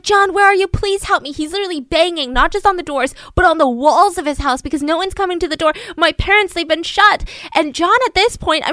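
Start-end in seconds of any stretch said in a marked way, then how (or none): 0.0-1.3 high, loud, panicked calling out; desperate shout. Retold or imitated. Son shouting for the neighbor's help, panicked and loud. 6.0-7.3 panicked cry. Retold or imitated. Son pleading at neighbor's walls.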